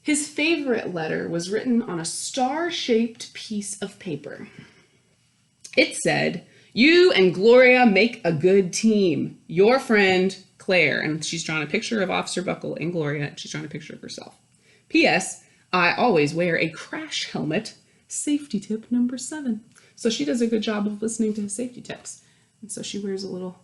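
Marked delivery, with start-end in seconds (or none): 0.0-11.0 put-on lively read-aloud voice for children; animated picture-book narrator; storybook narration. 14.9-19.6 put-on lively read-aloud voice for children; animated picture-book narrator; storybook narration.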